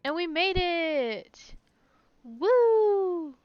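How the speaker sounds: slow, loud rising victory shout; triumphant cheer. Real, not acted. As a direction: celebrate like you just won. Celebrating reaching destination.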